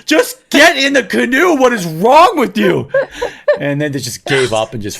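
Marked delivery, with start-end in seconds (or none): 0.0-2.9 loud, high, drawn-out exasperated shout; retold yelling. Berating family who can't get in the canoe, yelling. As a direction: yell each order slowly in exasperation. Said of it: "I was yelling at them so much".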